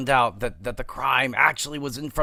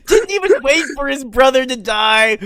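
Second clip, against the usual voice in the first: laugh-crying; 9 semitones higher, 5 dB louder than usual. Real, not acted. Laughing-crying over Renly.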